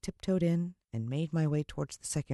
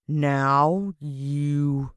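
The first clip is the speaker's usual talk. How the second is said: slow, louder sinister voice; deliberate villain; 4 dB louder, much slower than usual. A narrator's reading. Mister Motcher condemns the boy, slow and deliberate. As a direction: deliver it with cold finality. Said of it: "He spoke quite deliberately".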